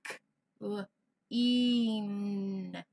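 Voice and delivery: "k, l, een" said sound by sound; segmented sounds. Shown as a demonstration. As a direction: break clean into its parts. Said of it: "I take off the".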